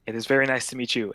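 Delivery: usual speaking voice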